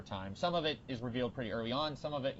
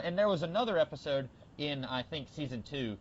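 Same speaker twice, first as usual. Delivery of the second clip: usual speaking voice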